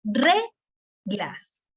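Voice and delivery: "reglas" split in two, first syllable punched, slow; stress on the first syllable. Shown as a demonstration. Teacher showing the tonic syllable, "reglas" with RE heavily stressed. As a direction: exaggerate RE in "reglas", let "glas" drop after it. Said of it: "Hay que pronunciarla de manera ... mucha énfasis".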